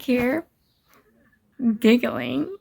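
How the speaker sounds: usual speaking voice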